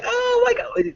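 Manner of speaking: very high squeal of pain. Retold or imitated. A contestant yelping while his nose is hooked, very high. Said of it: "He's like".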